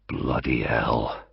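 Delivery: low, slow and hushed; said softly. A narrator's reading. Sharp swears softly to himself, hushed and slow. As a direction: breathe it out under the breath. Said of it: "he said softly".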